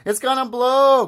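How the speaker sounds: high, loud panicked shout; alarmed cry. A narrator's reading. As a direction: cry out a frantic warning. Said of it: "cried Harold".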